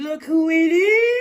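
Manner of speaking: loud, climbing singsong finish; singsong reveal. Real, not acted. Drawn-out singsong card reveal.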